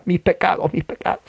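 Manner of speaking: imitating sobbed confession, dropping to a whisper midway; mock tearful penitent. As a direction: play a weeping penitent, voice falling away. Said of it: "¿Por qué llora?".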